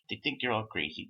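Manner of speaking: ordinary talk, nothing marked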